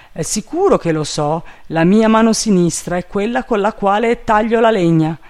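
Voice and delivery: high, loud servant voice; confident servant Jupiter. A narrator's reading. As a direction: insist naively. Voices the servant answering back.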